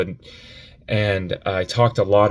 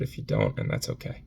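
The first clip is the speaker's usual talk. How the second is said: whisper; 12 semitones lower, 9 dB quieter than usual. Real, not acted. Tearful goodbye to viewers.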